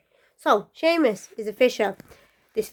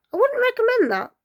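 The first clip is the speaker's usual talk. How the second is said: high warning; 13 semitones higher, 3 dB louder than usual. Real, not acted. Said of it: "warning listeners".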